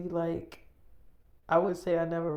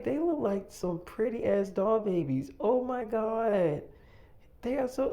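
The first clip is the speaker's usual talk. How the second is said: higher, slow gush on "Oh my God"; gushing again; 7 semitones higher than usual. Retold or imitated. Woman admiring the babies, slow high gush. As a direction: coo at the dolls, drawn out.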